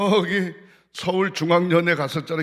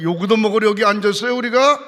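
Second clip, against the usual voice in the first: louder, higher indignant protest; a retold outburst, raised; indignant retort; 5 semitones higher, 8 dB louder than usual. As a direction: fire the question back, offended.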